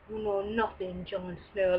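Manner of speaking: quiet, lightly imitated Ygritte accent; Ygritte's accent. Vlogger tries Ygritte's line in her accent. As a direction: murmur it in her wildling accent. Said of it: "I even try to do it".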